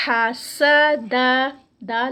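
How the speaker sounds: ہا and سا with a whistling sin, then a light دا; loud recitation. Shown as a demonstration. Teacher sounding out the letters of حسد. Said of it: "سین میں whistling sound".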